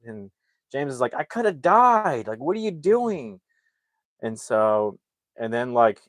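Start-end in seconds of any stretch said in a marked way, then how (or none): 1.2-3.4 high, stretched-out complaint; indignant protest. Retold or imitated. James scolding his brother after nearly suffocating, indignant. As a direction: act out a drawn-out, indignant protest.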